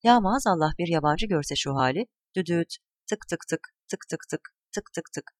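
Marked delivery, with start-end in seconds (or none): 2.4-5.3 soft, toneless train clicks; train imitation. Grandfather playing trains with grandchildren, soft train noises. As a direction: tick along quietly like wheels on rails.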